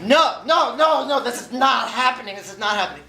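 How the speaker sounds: high, loud panicked no no no; panicked denial. Retold or imitated. Man realises they are locked out, loud high panic. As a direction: spiral into panic, voice shooting up. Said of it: "I start hyperventilating".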